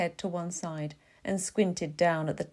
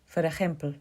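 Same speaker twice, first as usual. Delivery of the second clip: put-on thick Argentinian accent. A narrator's reading. Imitating Borges in an interview, thick Argentinian accent. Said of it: "his English blanketed in the thick Argentinian".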